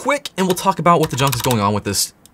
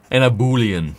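funny drawn-out word; 2 semitones lower than usual. Real, not acted. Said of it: "I don't know why I say it like that".

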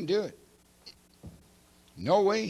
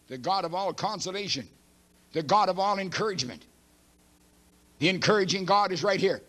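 ordinary talk, nothing marked

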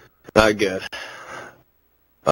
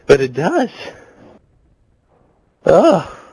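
voice cracking; 5 semitones higher, 3 dB louder, slower than usual. Real, not acted. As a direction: pitch jumping up mid-word. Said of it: "My voice is cracking really bad".

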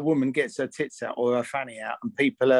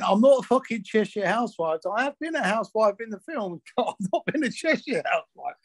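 higher, laughing Mancunian protest; Mancunian accent; 7 semitones higher than usual. Retold or imitated. She corrects Terry's housewife joke, laughing Mancunian accent. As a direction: imitate her indignant Mancunian retort through laughter. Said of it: "really thick Mancunian accent".

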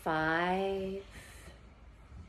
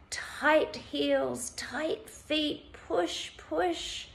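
raised pitch on short commands; urging to tense; 5 semitones higher, much slower than usual. Shown as a demonstration. Instructor urging listeners to push their heels down, urging to tense. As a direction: press each word upward with effort.

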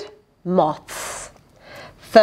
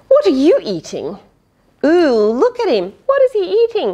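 eager wondering questions; excited character; 8 semitones higher, much faster than usual. Acted. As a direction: gasp it out like a delighted child. Character voiced in picture book.

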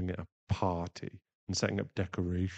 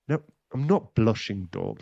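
higher, loud flustered protest; defensive denial; 3 semitones higher, 10 dB louder than usual. Acted. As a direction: deny it too hard, embarrassed. Denies blushing when teased.

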